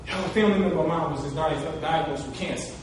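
low, soft, hurried telling; somber news. Retold or imitated. Teammate telling captain, low and hushed. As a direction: drop it quietly like bad news. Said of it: "he was like".

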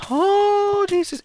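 very high startled cry; sudden high-pitched alarm. Real, not acted. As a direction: yelp in real shock. Sees flame arrows.

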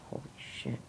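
breathy whispered shock. Real, not acted. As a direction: whisper it under your breath. Reacting to getting fat.

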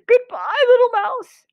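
high, loud farewell; hammed-up fox fright; panicked fox. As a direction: cry goodbye while fleeing. Fox flees.

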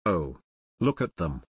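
low, understated shocked reaction; gasping. A narrator's reading. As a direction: take it in with hushed dismay. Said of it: "She stopped and gasped".

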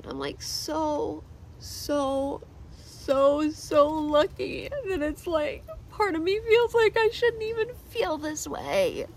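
high, halting voice choked with tears; tearful. Real, not acted. Telling viewers she feels lucky yet guilty, tearful. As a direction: stretch the words, climbing as you break down.

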